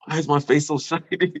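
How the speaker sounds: high, amazed question with a laugh breaking in; laughing surprise at the mirror. Retold or imitated. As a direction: marvel at your own glowing face.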